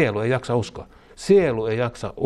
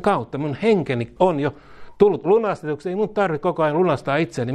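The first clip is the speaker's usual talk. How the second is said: high, raised preaching voice; preaching at full force; 6 semitones higher than usual. Acted. Preacher proclaiming authority to the church, fervent preaching. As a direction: declare it with fervour.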